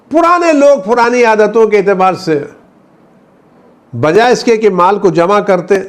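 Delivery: high-pitched, insistent sermon; impassioned preaching. Real, not acted. Preacher rebuking old habits of looting, impassioned. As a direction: press each phrase on the congregation, pitched high.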